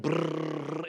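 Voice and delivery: lip-trilled engine noise; propeller buzz. Retold or imitated. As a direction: imitate a droning propeller plane. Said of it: "it's just going".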